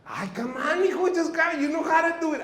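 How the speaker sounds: high, loud, cajoling uncle; coaxing Tio Chuy. Retold or imitated. Tio Chuy coaxes tired nephew, high and loud. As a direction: nag him to pitch in.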